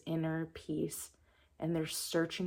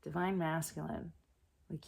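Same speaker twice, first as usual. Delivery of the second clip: ordinary talk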